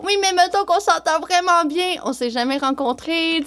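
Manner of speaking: high, whiny imitation of a date. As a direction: squeak it out like an anxious guy. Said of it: "la voix que je prends tout le temps pour les imiter".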